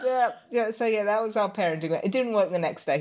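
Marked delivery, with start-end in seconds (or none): none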